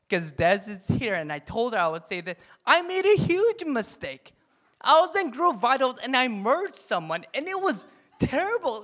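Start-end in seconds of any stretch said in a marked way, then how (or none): 2.6-8.8 much higher, louder, dramatic confession; excited confession. Real, not acted. Pastor confesses a work mistake to the congregation, higher and louder. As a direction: blurt out the disaster with mock alarm.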